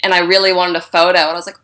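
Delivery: normal talking voice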